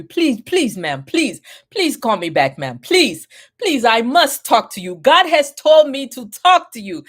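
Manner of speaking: put-on loud, begging in a thick African accent; pleading African accent. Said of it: "he had a really thick African accent".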